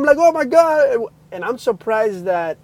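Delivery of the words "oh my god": high, loud gasp of amazement; retold amazed shout; going crazy. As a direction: cry it out in disbelief. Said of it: "and I'm going crazy".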